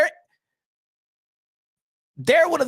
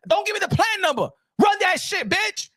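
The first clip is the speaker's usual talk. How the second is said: mock angry shout; 10 semitones higher than usual. Streamer retelling yelling at the support rep, mock shout. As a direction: snap at the rep, hammed up. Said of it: "they giving me the plan number".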